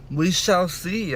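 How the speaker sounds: slow, drawn-out musing; mock ominous. Real, not acted. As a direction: drag it out like a prophecy. Musing on the story's outcome.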